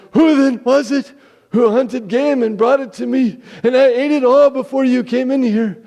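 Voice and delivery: put-on loud, high, shaken old father; trembling. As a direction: blurt it out in sudden dread. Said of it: "began to tremble violently".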